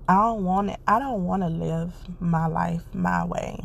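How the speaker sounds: usual speaking voice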